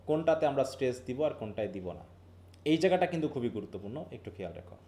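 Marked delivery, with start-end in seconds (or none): none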